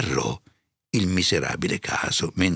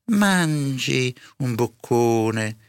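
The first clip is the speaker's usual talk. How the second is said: higher, very slow coaxing; coaxing housekeeper; 6 semitones higher, 3 dB louder, much slower than usual. A narrator's reading. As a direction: draw the words out to tempt him to eat. Serva esorta il padrone a mangiare. (serva che esorta, lenta e più acuta)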